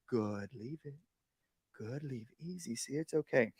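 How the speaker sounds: calm the dog down gently, almost under your breath; hushed reassuring praise once the barking stops; soft praise. Shown as a demonstration. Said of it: "as soon as they stop the barking".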